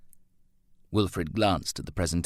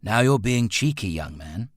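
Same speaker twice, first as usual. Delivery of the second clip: firm fatherly telling-off, louder start; stern; 5 semitones higher, 4 dB louder than usual. A narrator's reading. Father scolds his cheeky son, stern. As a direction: start high and firm, drop on the address. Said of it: "Wilfred said sternly".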